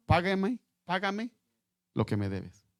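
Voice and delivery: high, sharp mock demand from a choking creditor; demanding servant. Preacher acting the cruel servant demanding payment, high and pressing. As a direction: press him hard for the money. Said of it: "le ahogaba diciendo".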